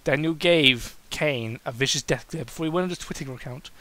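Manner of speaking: ordinary delivery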